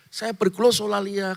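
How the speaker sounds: ordinary delivery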